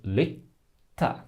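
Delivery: "litta" with a silent break before the t releases; long T. Shown as a demonstration. Teacher doing the same with t, broken "litta". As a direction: hold the stop inside "litta". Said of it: "We do the same with".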